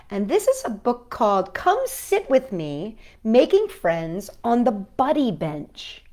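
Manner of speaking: ordinary delivery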